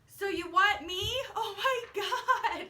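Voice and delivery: high, loud stunned squeal breaking into a laugh; freaking out. Retold or imitated. Retelling the proposal to viewers, imitating her squealing shock. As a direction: act out her giddy disbelief, laughing. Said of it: "she's freaking out".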